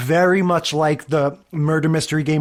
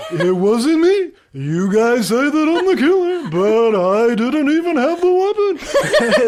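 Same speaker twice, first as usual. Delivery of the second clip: put-on a would-be French accent that slides into Yogi Bear, higher and louder, laughing through it; 8 semitones higher, 4 dB louder than usual. Recalling a teen murder-mystery party, bad French accent turned Yogi Bear. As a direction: ham up a terrible French accent that comes out as a cartoon bear. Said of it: "my French accent ended up being the Yogi Bear accent".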